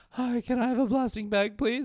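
imitated near-tears politeness. Stressed diner asking at the counter, mock near tears. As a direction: ask politely, pretending to hold back tears. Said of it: "I'm about to start crying".

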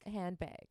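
"Handbag" ending like "bayg"; Wisconsin A. Shown as a demonstration. Answering the co-host's example, "Handbag" with a Wisconsin "bayg". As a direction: raise the last vowel of "Handbag" toward "beg". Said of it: "it is a thing with our A's".

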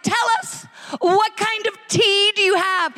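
chirpy high-pitched tourist voice; overexcited tourists. Retold or imitated. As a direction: ask about the tea as if it's a marvel. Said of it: "they were like".

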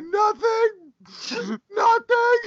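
very high squeaky sob-voice; mock tearful denial. Boyfriend caught crying by girlfriend, mock-tearful denial. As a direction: imitate choking back tears, comically overdone.

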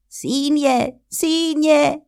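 calling his brother, pitched high. A narrator's reading. Hare in pain calls to his brother, high cry. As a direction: cry out for help from the tree. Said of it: "Άρχισε να φωνάζει".

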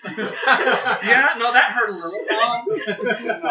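high, loud laughing through words. Real, not acted. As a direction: laugh while protesting the joke. Teased by friends, laughing.

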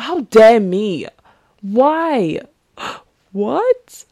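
hammed-up disbelief with a gasp, slow and loud on "How dare me"; mock outrage. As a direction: play up shocked indignation at yourself. Retelling own outraged reaction.

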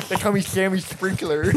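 spluttering s in "Sammy Sprinkler"; slushy sibilants on "Sammy Sprinkler"; lisped. Pretend. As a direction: introduce yourself with a wet fake lisp. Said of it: "like people with lisps".

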